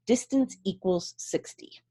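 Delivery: whispered as she writes it down. Acted. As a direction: breathe the words like a secret note. Princess writes on clipboard.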